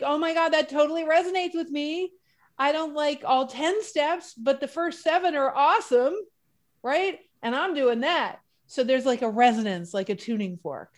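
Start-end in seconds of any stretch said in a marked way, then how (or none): 0.0-8.4 mock excited gush. Inspired inner voice quoted.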